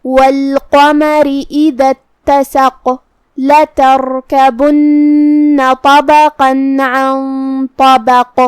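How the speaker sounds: slow chanted tajweed recitation; Quran recitation. Acted. Teacher reciting the verse for students, slow chant. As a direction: draw each verse out, melodic and measured.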